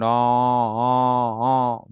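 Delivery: low drawn-out lowing in reply; cows answering. Acted. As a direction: answer the call like a cow. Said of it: "cows began to respond".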